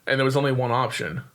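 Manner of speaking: normal talking voice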